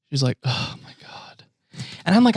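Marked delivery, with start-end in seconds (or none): 0.4-1.4 breathy whispered oh my God; exasperated whisper; whispered groan. Retold or imitated. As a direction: mutter it under your breath, slow. Comedian's exasperated aside retold.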